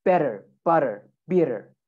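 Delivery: American "Better, butter, bitter" with a D sound for tt; American accent. Shown as a demonstration. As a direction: flap each double T, American style. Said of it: "make that sound like a D sound".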